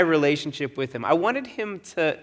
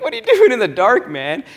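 chuckling; 8 semitones higher, 6 dB louder than usual. Retold or imitated. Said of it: "I just chuckled".

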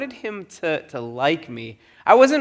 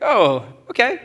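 light, casual, higher and louder; breezy approval; 8 semitones higher, 5 dB louder than usual. Retold or imitated. To roommate planning the walk, breezy approval. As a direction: shrug it off as no big deal. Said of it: "And I was like".

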